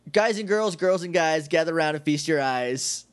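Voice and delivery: ordinary talk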